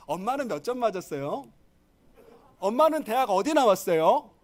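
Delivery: mock child's comeback; cheeky child. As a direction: turn the question back on mom, building. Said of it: "라고 물어보라고 가르칩니다".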